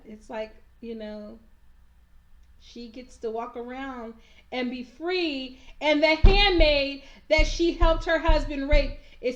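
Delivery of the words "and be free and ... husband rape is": angry outburst. Real, not acted. Angry rant about Serena Joy.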